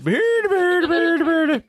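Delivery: high, squeaky silly bird call. Retold or imitated. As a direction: chirp it out like a cartoon bird. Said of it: "Be like".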